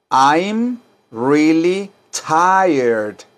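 very slow, drawn-out teacher model; slow model for repeating. Shown as a demonstration. Teacher to students, drawing out each word slowly. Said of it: "Díganlo".

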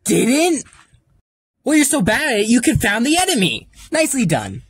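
normal talking voice

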